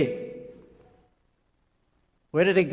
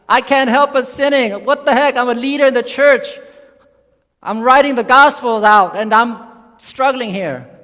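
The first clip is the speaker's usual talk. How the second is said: raised, exasperated voicing of an inner struggle; exasperated confession; 4 semitones higher, 5 dB louder than usual. Preacher voices Paul admitting he can't stop sinning, exasperated. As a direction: imitate a leader frustrated with himself.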